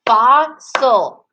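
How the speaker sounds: "Fossil" in two separate, emphatic beats; deliberate syllable-by-syllable "Fossil"; clapped-out syllables. Shown as a demonstration. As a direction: split "Fossil" slowly, one clap per syllable. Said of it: "We're gonna do it one more time".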